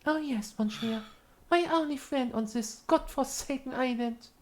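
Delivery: high, fawning voice; servile character voice. Acted. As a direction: grovel politely to the Monsieur.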